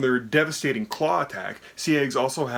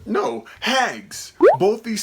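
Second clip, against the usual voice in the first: excited; 9 semitones higher, 6 dB louder, much slower than usual. Real, not acted. Answering the quiz question.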